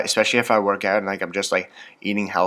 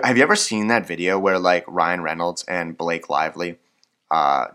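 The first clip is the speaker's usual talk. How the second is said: usual speaking voice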